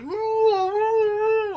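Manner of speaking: put-on hooting like a lightweight drunk; mock drunk whoop. Drunk kid mocked as a wuss, high whooping. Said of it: "like that".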